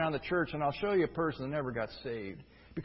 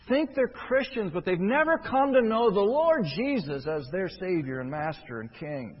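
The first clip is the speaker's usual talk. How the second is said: ordinary delivery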